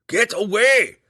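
high, loud shout of warning; urgent cry. A narrator's reading. Belwar to his friend from the bottom of the stairs, crying out. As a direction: cry it out from a distance. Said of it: "Belwar cried from the bottom".

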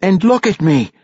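high, loud motherly command; scolding. A narrator's reading. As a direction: demand attention like an angry mother. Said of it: "she scolded".

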